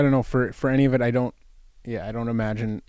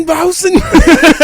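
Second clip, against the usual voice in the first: put-on high, loud, slow thick German accent; mock thick German; 11 dB louder, slower than usual. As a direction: bellow it in a hammed-up German accent. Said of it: "the thick German".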